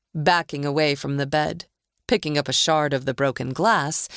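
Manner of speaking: usual speaking voice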